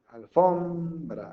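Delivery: alFOMbra with a long, high FOM; Alfombra, dwelling hard on FOM; correct stress on FOM. Shown as a demonstration. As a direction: stretch FOM out in Alfombra, very slowly. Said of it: "aquí está la fuerte".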